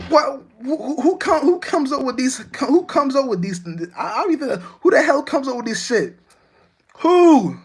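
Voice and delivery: high, loud incredulous shouting; hammed-up outraged questioning; exasperated ranting. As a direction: demand an answer, half yelling in disbelief. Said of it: "Be like".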